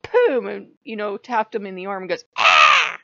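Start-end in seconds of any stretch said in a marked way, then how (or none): 0.1-0.5 mock boom. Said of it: "I went". 2.4-3.0 high loud fake screech of pain; mock screech. Loki hamming up a tap on the arm, loud high screech. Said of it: "Screeches like I just killed him".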